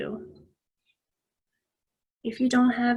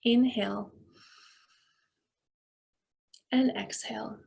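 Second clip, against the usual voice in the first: guided breathing. Real, not acted. Leads a breathing exercise.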